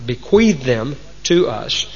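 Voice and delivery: ordinary talk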